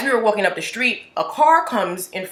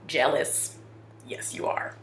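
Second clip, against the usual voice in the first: whispering; 3 semitones lower, 10 dB quieter, slower than usual. Real, not acted. Teasing aside.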